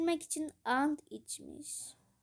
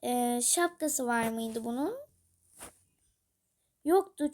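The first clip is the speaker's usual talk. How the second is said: ordinary delivery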